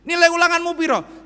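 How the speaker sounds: loud, high scolding mother; stern old-school parent. Retold or imitated. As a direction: grill the child about the score. Old-style parent scolding child. (ibu galak zaman dulu, keras dan tinggi)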